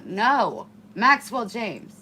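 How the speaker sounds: stern loud scold to dog; full-name warning. Real, not acted.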